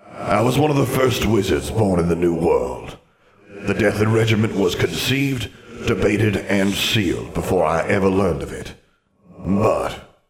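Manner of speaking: echoing ghostly wizard voice; formal spectre with a hollow reverb; reflective ghost. Acted. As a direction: recount the past as a proud old phantom. Ghost recounts his past.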